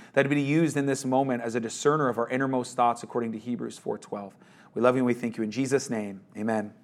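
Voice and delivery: soft, low prayer voice. Real, not acted. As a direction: pray it quietly and reverently. Closing prayer before the sermon.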